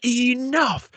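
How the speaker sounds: sudden high yelled Enough!; imitating a furious outburst; retold scream. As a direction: snap it out like bowing up at a rival. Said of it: "starts screaming at Kevin Owens".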